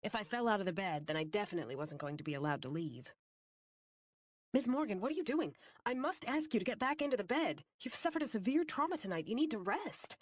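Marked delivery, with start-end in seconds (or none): 4.5-10.1 higher, urgent doctor voice; worried doctor pleading with patient; anxious doctor. A narrator's reading. Doctor urging patient back to bed.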